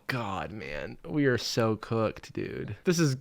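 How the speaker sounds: ordinary talk, nothing marked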